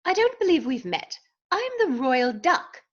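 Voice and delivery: high-pitched British royal duck; British accent. A narrator's reading. Royal duck introduces himself to Dodsworth, British accent. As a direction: introduce yourself in grand British tones. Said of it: "He spoke with a British accent".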